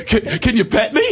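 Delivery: high, loud puppy-like plea through laughter; mock eager puppy. Laughing as he mocks himself as a needy puppy. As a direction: beg like a dog wanting pets.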